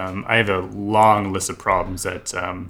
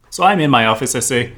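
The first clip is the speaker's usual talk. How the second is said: ordinary talk, nothing marked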